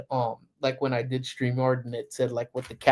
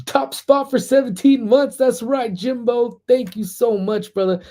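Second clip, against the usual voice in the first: high, loud, slow excited shout-out; excited thanks; 10 semitones higher, 5 dB louder than usual. Real, not acted. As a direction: hype up the chatter with delight. Thanking a chatter on stream.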